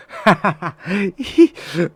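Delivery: high self-mocking laugh. Real, not acted. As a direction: laugh at your own stupidity. Laughing at own blunder.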